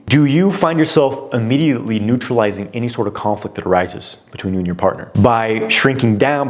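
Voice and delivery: normal talking voice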